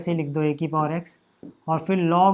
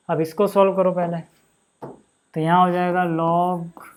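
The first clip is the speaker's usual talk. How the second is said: ordinary delivery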